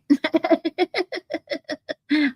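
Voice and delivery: high amused laugh. Real, not acted. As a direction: burst out laughing at the joke. Laughing at being liked yelled.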